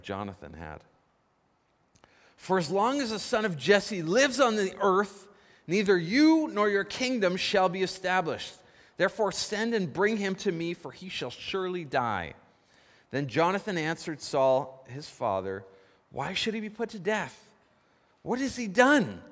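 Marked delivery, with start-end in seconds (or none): 2.4-12.3 loud, high, raging king's decree; angry tirade. Retold or imitated. Saul ordering Jonathan to fetch David, loud angry demand. 16.1-19.2 protesting son. Retold or imitated. Jonathan protests to his father.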